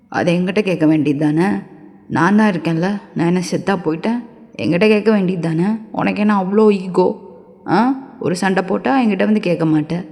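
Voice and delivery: stern [name]. Acted. (கண்டிப்பான தாழ்ந்த ஆண் குரல்)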